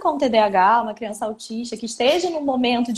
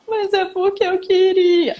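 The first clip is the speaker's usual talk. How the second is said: put-on high, slow whiny child voice; child still upset about the cartoon; sulky child; 6 semitones higher, 4 dB louder, slower than usual. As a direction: drag it out like a sad little kid.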